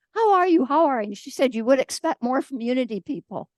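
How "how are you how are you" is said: raised, louder mock of a hollow greeting; bored rote greeting. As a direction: toss it off like an empty habit. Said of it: "so tired of people coming up and saying".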